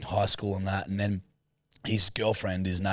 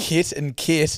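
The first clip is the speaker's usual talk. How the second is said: put-on New Zealand accent; 9 semitones higher, 6 dB louder, much slower than usual. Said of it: "your very New Zealand accent".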